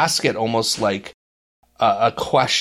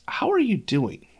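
sincere, concerned "How are you doing?" with a lifted pitch; caring tone; 10 semitones higher than usual. Retold or imitated. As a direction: ask "How are you doing?" like you really want the answer. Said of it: "But it was how he said it".

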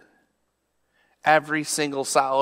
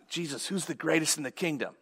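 softly voiced disciple's question; hushed, acted question; quiet question; 2 semitones higher, 9 dB quieter than usual.

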